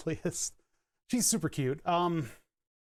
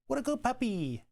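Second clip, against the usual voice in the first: much higher cooing praise; 9 semitones higher than usual. Real, not acted. Praising the corgi in high baby talk. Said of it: "Sorry for the baby talk".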